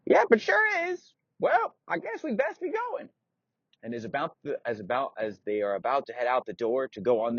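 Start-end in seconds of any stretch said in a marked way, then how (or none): 0.1-3.1 put-on very high, creaky old-man squeak; a doddery old knight, indignant; old knight insists he is still strong.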